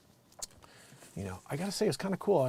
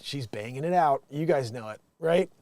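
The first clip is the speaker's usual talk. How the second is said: ordinary delivery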